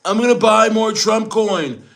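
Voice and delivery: high-pitched mock presidential announcement; Trump. Streamer imagines president leaving trade talks, high mock voice. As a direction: play the president in a silly high voice. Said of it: "Trump's just like".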